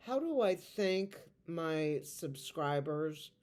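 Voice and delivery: ordinary talk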